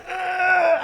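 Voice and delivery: high strained ah, pulling free; a high yelp of effort as he's dragged back; high strained cry of effort; straining cry. Retold or imitated. Guard pulling him back.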